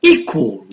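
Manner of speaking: "Equal" with E stressed; stress on first syllable. Shown as a demonstration. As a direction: lean on the E of "Equal", then drop. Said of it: "the first".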